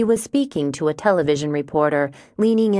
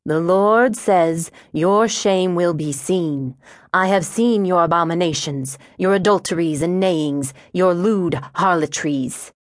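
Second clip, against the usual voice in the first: sermonizing zealot. Acted. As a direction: proclaim scripture at a sinner, stretching the key words. Preacher-like antagonist quoting scripture.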